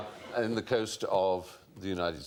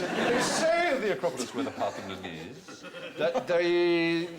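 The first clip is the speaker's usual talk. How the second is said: slow, higher, laughing through it; laughing slow recital; 7 semitones higher, 3 dB louder than usual. Acted. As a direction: drag the phrase out laughing.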